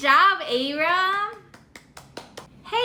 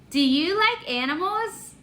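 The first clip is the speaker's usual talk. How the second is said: normal talking voice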